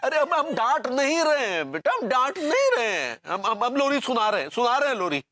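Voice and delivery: put-on high-pitched, hurried reassurance to a crying child; frantic placating. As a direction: plead upward, desperate to calm the child. (घबराकर बच्चे को मनाते हुए)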